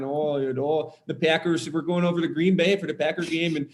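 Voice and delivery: playful Wisconsin accent demo. Shown as a demonstration. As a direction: do a thick Wisconsin Packers fan. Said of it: "Sam in a Wisconsin accent".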